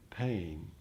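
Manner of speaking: ordinary talk, nothing marked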